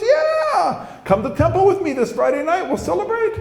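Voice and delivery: very high, exuberant reassuring voice; hammed-up squeaky, emphatic enthusiasm; Rich. TV man reassuring job prospect, very high exuberant voice. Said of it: "this one guy named Rich".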